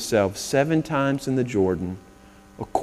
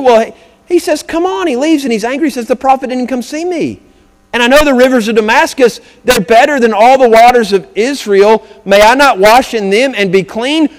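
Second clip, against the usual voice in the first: loud, high, ringing preacher's voice; fired-up Sunday sermon cadence; preaching at full force; 10 semitones higher, 10 dB louder than usual. Acted. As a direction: preach it out to the whole congregation. Preaching Naaman's anger.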